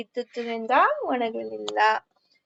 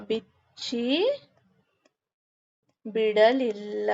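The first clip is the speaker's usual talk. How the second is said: dictation; slower than usual. Acted. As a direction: stretch each word so the class can write it. Dictating answer for writing.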